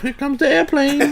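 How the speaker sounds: put-on slow singled-out baby-feeding tease; drawn-out, playful airplane call; mock spoon-feeding. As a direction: tease him as if feeding a toddler.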